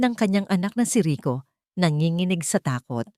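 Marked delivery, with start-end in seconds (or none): none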